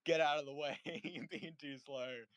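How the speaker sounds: imitating an impatient local barking at them, laughing; mock angry yell. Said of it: "Australian family was like".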